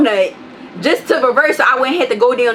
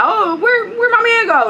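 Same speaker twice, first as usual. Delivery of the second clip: much higher, cheerful searching call; imitating Legion; 12 semitones higher than usual. Legion hunting for the man, high mock call. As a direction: mimic Legion looking for his friend.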